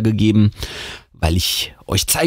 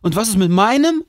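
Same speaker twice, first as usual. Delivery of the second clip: hohe, genervte Nachfrage; genervt; 12 semitones higher, faster than usual. A narrator's reading. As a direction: sich mit hoher Stimme beschweren, übergangen zu werden. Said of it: "fragt Josh genervt". (genervt fragender Bruder)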